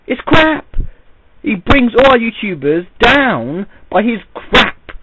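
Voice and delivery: loud, high, furious rant; angry rant. Real, not acted. Angry rant against Fred.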